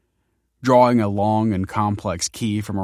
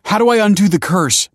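high, loud desperate plea; raised-voice begging; 12 semitones higher, 9 dB louder than usual. A narrator's reading. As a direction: beg urgently for an answer. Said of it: "begged Michael".

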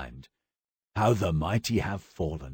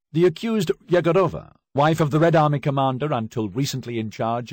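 loud, high, formal reading of the charges; stern prosecutor; 6 semitones higher, 3 dB louder than usual. Acted. Prosecutor reading charges to the accused, loud and formal. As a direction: announce the indictment to the whole court.

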